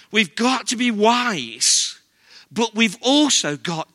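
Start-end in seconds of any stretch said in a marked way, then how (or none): none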